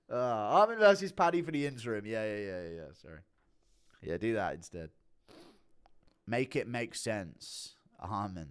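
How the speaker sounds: normal talking voice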